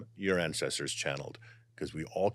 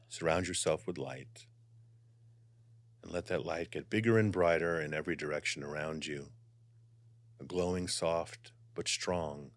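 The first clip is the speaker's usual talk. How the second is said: guided meditation; 5 dB quieter than usual. Acted. Guiding viewers to relax before channeling, soft meditation voice. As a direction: ease the listeners into calm.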